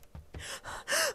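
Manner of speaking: sharp, breathy frightened gasp. Acted. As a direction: gasp in terror. Panic at footsteps.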